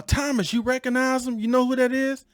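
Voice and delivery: high, playful voice of a coworker; teasing coworker. Retold or imitated. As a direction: egg the friend on to guess. Coworker nudging Thomas, retold.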